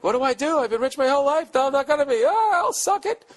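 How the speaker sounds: put-on high, frantic rich girl voice; panicked rich girl. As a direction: mimic her spoiled panic, way up high. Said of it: "She's freaking out".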